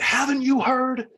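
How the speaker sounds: high, loud, drawn-out mock yell; retold yell back. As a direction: throw the yell back with matching energy. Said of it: "I yelled right back at him".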